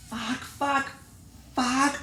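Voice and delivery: high, hushed gasping swearing; high whispery disbelief; stunned. Acted. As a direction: swear under your breath in shock. Sees which pile squirrels chose.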